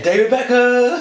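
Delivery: high, loud, slow announcer cry; mock announcement. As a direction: shout the name like a showman. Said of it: "a sign above his head saying".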